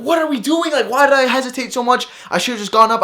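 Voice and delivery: high, exasperated self-reproach; mad at himself. Retold or imitated. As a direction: berate yourself for hesitating. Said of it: "I was so mad at myself".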